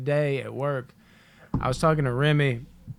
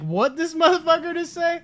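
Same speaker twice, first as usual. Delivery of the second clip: high, incredulous imitated voice; mock offended bystander; 14 semitones higher, 4 dB louder than usual. Group reacting to his slip, high mock disbelief. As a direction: imitate a stunned onlooker, pitched way up.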